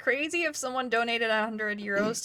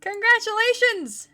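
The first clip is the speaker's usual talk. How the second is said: excited congratulations; 12 semitones higher, 8 dB louder than usual. Real, not acted. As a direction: cheer for the winner. Congratulating the winner.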